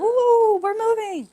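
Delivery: high, drawn-out gleeful "ooh"; excited kids. Retold or imitated. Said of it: "they were all excited".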